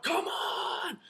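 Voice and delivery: very high, strained yell; imitated fan's shout. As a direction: yell it out like a lone heckler. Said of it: "suddenly someone shouts out".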